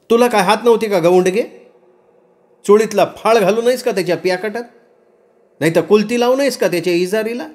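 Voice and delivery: high-pitched indignant village woman; heated, high-pitched reproach; angry scolding. Acted. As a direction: scold her with sharp rising questions. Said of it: "Mukta रागानं म्हणाली".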